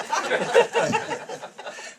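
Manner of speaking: high, airy, wheezing laughter; real wheezy laugh; breathy laugh. Real, not acted. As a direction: laugh it off after the punchline. Laughs after the punchline.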